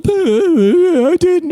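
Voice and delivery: mock-sob it like a seven-year-old; imitating bawling child. Retelling his dad's scolding, imitating his own childhood bawling. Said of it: "And I was just bawling".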